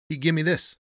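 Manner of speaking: loose-tongued porter, pitched well up. A narrator's reading. Porter shows Tony the dollar bill, loosely. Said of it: "he said loosely".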